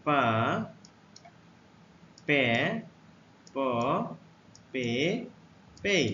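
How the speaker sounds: teacher modelling পা পে প পে পেই; letter sounds demo. Shown as a demonstration. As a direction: model পা পে প পে পেই with a pause after each. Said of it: "পি এর উচ্চারণ".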